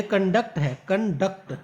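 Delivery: Conduct with the stress on duct; stress on duct. Shown as a demonstration. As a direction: lean on the second syllable of conduct. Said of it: "duct को stress दिया गया". (Duct पर ज़ोर)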